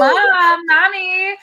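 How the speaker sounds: high, loud crying wail of Mommy; son screaming for mom. Retold or imitated. Son crying out for mom as they walk in, high loud wail. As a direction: wail it like a clingy toddler. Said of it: "of course you're gonna hear him scream".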